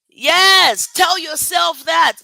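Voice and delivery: loud, slow, hammered home; emphatic shout. Real, not acted. Motivational speaker urging her viewers, loud slow shout.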